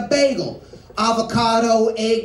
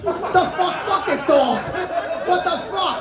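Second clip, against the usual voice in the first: mock outrage; 8 semitones higher than usual.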